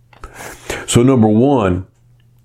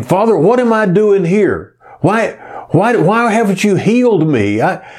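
high, whiny complaint to God; retold bedside griping, voice up high; 8 semitones higher than usual. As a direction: gripe as if wronged. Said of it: "I lay there griping at God".

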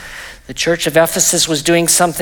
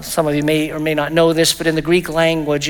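usual speaking voice

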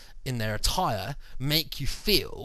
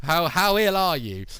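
higher, louder, slow mocking question; hammed-up clerk asking how ill; mock receptionist; 5 semitones higher, 6 dB louder than usual. Receptionist sizing up the patient, mock loud.

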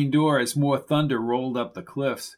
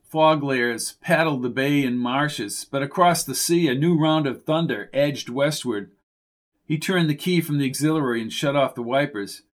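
ordinary delivery

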